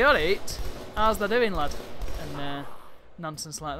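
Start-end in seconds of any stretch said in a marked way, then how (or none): 0.0-1.7 loud, hearty Yorkshire greeting; booming Yorkshire hello; Yorkshire accent. Acted. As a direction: hail a mate in broad Yorkshire. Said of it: "I know what Yorkshire people say".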